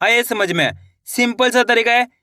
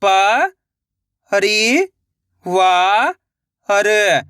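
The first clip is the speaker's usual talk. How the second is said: "प-रि-वा-र" very slowly, each syllable held; sounding out slowly; much slower than usual. Shown as a demonstration. Teacher showing children how to sound out a word. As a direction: sound out "प-रि-वा-र" piece by piece. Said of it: "एक एक अक्षर का उच्चारण करके".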